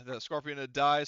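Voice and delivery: ordinary talk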